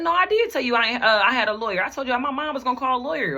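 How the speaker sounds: high-pitched arguing back; retold insistent rebuttal. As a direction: re-enact a heated denial, pitched well up.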